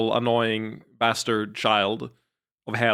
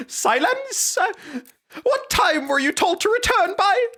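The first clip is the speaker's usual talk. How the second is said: much higher, louder squeaky falsetto; squeaky falsetto master; 4 dB louder than usual. Shown as a demonstration. Streamer redoing the young master in a squeaky falsetto. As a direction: squeal the order like a cartoon brat. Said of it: "this is a dog shit character voice".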